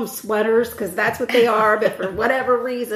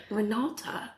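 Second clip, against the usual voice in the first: British accent; 3 semitones lower, 12 dB quieter, slower than usual. Shown as a demonstration. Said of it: "I'm saying it in a British accent".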